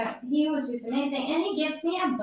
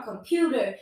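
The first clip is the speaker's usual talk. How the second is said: ordinary talk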